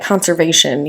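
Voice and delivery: ordinary talk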